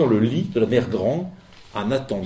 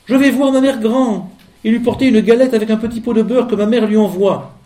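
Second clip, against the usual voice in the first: voix de fillette; 9 semitones higher, 6 dB louder than usual. Acted. La fillette répond au loup.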